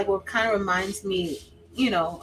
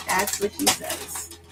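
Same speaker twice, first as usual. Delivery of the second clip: slow take; 4 dB quieter, slower than usual. Shown as a demonstration.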